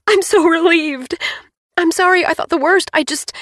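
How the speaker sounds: high, loud, fast relieved babble; tearful relief. A narrator's reading. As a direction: blurt out relief through tears. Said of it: "crying with happiness".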